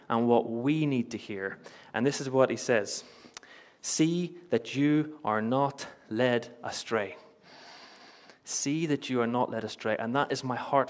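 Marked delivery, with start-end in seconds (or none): 3.8-7.2 very slow, spaced-out words; weighty, drawn-out scripture quote; solemn quoted warning. Acted. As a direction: deliver the warning word by word. Preacher quotes Jesus's warning.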